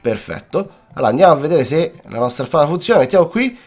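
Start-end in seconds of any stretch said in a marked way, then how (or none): none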